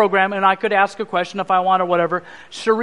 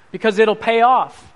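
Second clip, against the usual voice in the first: usual speaking voice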